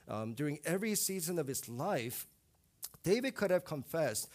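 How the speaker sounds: ordinary talk, nothing marked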